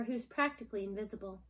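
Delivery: ordinary delivery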